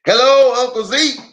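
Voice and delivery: cheerful country nephew greeting; hailing Uncle Zeke; Lem's greeting. Acted. Lem greets his uncle.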